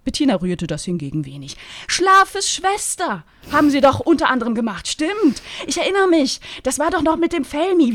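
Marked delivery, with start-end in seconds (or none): 1.9-7.9 high, loud and brisk; eager agent. Acted. Bettina recalling the diva's films, high and eager. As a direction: rattle off her memories eagerly.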